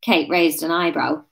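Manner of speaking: usual speaking voice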